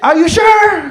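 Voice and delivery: high, loud question over gunfire; doubtful yell back. Retold or imitated. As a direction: shout your doubt back at the caller. Yells back during retold firefight.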